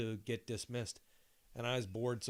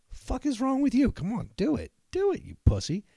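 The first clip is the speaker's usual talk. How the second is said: higher, louder goading; taunting yell; 11 semitones higher, 9 dB louder than usual. Retold or imitated. Narrator goading his friend during mass, loud and high. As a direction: egg him on loudly. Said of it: "I'm like".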